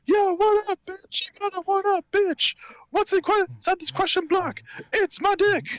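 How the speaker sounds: very high squeaky falsetto; absurdly high cartoon voice. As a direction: quote the comedy song in a silly falsetto. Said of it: "Luigi's Ballad by Starbomb".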